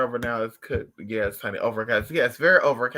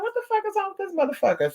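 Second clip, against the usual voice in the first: much higher, startled cry of disbelief; shocked disbelief; faster than usual. Retold or imitated. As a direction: squeal it like you can't believe your eyes. Retold bafflement at dog.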